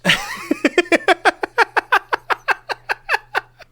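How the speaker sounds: high giggling laughter; high long laugh. Real, not acted. Laughs at taking a knee.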